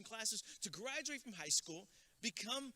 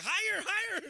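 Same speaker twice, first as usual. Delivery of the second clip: loud, high-pitched calling out; high mock shouting; 12 semitones higher, 11 dB louder than usual. Mimicking cholos fighting like piñata players, high mock shouting.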